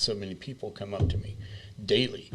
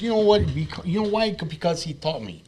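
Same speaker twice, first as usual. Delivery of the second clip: much higher, louder put-on voice; 10 semitones higher, 5 dB louder than usual. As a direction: show off the odd high voice. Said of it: "You want me to talk like this".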